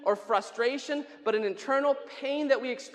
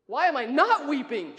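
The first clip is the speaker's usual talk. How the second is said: loud protest with "not" punched; indignant stress on "not"; 3 semitones higher, 5 dB louder than usual. Acted. Question turned round in protest.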